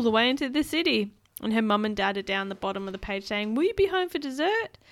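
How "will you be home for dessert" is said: high calling parent. Acted. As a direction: call up to her. Parents call up.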